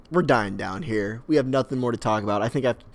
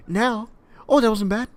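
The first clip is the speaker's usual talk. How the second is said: mock relief; 10 semitones higher, 4 dB louder than usual. Force-fed victim reacts in bit.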